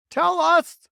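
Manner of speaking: high, loud demand; cried out. Acted. Earthworm begging James to tell, loud high cry. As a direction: shriek for the answer. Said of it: "cried the earthworm".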